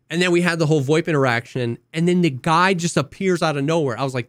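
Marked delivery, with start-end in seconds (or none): none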